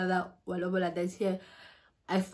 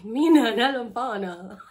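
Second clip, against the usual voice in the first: slow, laughing through the words; laughter breaking into the line; giggling; 6 semitones higher, 6 dB louder than usual. Real, not acted. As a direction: giggle it out, half embarrassed. Laughing while telling friend.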